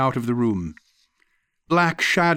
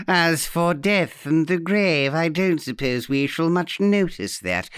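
mocking echo; 3 semitones higher than usual. Aunt throwing the guest's words back, mockingly. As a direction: quote it back with sly relish.

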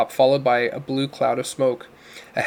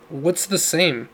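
ordinary delivery

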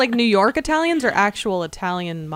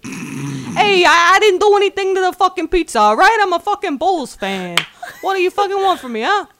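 loud, high, hammed-up Italian-American accent; indignant Italian wise guy, played big; Italian impression; 9 semitones higher, 5 dB louder than usual. Bulls fan denying he tampered with pizza, mock angry Italian accent. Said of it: "gonna do an Italian impression".

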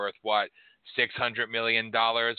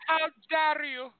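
Greta Thunberg impression. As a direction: shriek it like the meme girl. Repeats meme line on request.

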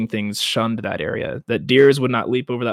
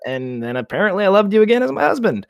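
sarcastic aside; 8 semitones higher, faster than usual. Real, not acted. Mocking aside about the incest.

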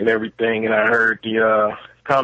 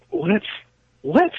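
incredulous; 9 semitones higher than usual. Real, not acted. Caller stunned by the remark.

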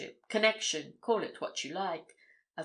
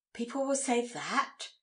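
higher-pitched, startled disbelief; exclaiming question; 4 semitones higher than usual. A narrator's reading. Daughter hearing what the public will say, exclaiming. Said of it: "she exclaimed".

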